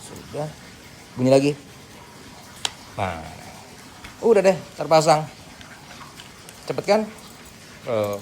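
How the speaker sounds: ordinary delivery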